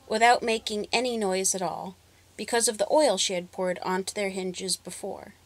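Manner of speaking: ordinary delivery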